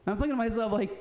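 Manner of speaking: normal talking voice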